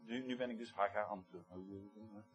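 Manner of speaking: low, quiet mumble to oneself; half-voiced murmur, turned inward; muttering half aloud. Shown as a demonstration. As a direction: mumble it under the breath, low and soft. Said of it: "In de zin van halfluid lezen".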